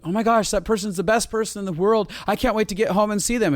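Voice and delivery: high, eager and gushing; gushing delight. Retold or imitated. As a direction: sound thrilled to see them. Grateful partner's inner voice.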